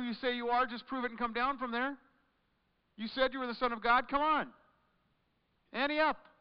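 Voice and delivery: loud, high taunting jeers; mocking jeer. Passersby taunting Jesus on the cross, loud high mockery. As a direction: sneer it out like the crowd at the cross. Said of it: "mocking and making fun of and saying".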